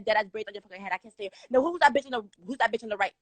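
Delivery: ordinary talk, nothing marked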